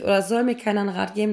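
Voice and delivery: usual speaking voice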